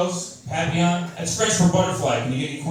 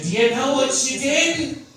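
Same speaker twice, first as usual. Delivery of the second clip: wife voice, higher and slow; high slow wife nag; 9 semitones higher, slower than usual. Retold or imitated. Wife greeting him at the door, high slow scold. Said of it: "She said".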